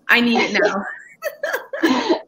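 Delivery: high, very slow, laughing; playful pretend desperation; laughing mock plea. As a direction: beg for it through a laugh, half crying in jest. Host jokes she wants the series.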